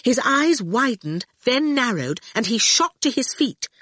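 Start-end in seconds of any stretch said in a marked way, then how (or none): none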